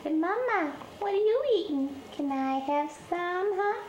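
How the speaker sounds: voicing a kitten.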